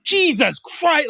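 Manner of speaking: high, slow exasperated exclamation; upset dad. Retold or imitated. Dad learning why his kid cries, upset. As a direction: imitate a dad fed up with the crying. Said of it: "he was so upset".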